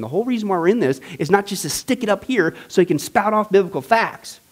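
usual speaking voice

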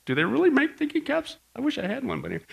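put-on a kid daydreaming aloud; childlike wondering. Pastor recalling his schoolboy thoughts, light wistful wonder. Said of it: "but I'm going".

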